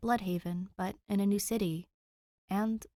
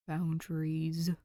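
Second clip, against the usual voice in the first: slow, sulky low mumble; dejected mutter; 3 semitones lower, slower than usual. A narrator's reading. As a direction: mumble it like a scolded kid. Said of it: "Danny mutters dejectedly".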